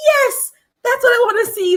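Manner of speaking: high, loud, delighted exclamation; thrilled. Retold or imitated. Viewer retelling her delight at the honest video. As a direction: cheer it like you finally found the real thing. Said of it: "And I was like".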